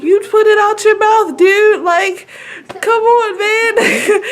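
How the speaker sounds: high, squealed whiny protest; hammed-up high-pitched complaining; mock exasperation. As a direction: imitate an exasperated mom, voice way up high. Acts out exasperation with baby.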